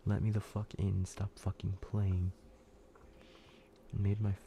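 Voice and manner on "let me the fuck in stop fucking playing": usual speaking voice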